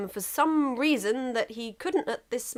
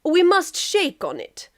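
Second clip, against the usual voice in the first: Russia insisting; 8 semitones higher, 5 dB louder, slower than usual. Acted.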